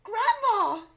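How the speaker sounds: very high, loud shriek. A narrator's reading. Wife shrieking at grandma. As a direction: shriek her name. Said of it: "shrieked Missus Cranky".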